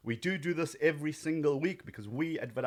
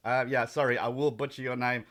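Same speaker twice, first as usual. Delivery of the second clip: ordinary delivery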